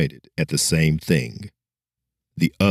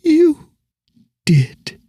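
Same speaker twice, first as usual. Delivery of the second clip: put-on much higher, louder girl's voice; dying girl; 6 dB louder than usual. Dying girl reassuring the hero, high and earnest. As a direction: press the point home gently.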